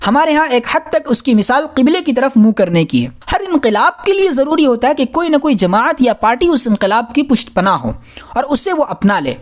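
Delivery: ordinary talk, nothing marked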